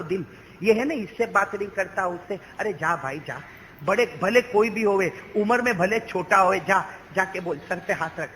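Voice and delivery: ordinary delivery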